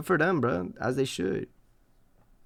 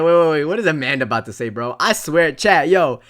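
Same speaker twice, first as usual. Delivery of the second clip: louder urgent wait, fast; excited; 7 dB louder than usual. Real, not acted. Streamer hushing chat before Amanda speaks, excited. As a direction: hype up the moment for chat. Anticipates what Amanda will say.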